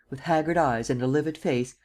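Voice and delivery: plain reading voice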